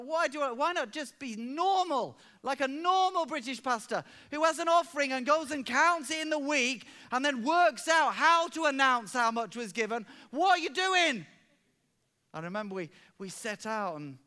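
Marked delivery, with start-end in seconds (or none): 0.0-11.3 exasperated. Retold or imitated. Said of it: "And I was like".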